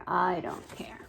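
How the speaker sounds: put-on breathy whisper of a sulky child; hushed little-girl whisper. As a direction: whisper it like you're pretending not to care. Sulky girl turns away.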